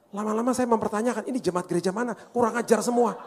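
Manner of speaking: high-pitched comic outburst of frustration; playfully indignant complaint; mock exasperation. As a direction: finally lose patience, voice jumping up. Mock exasperation at annoying drivers.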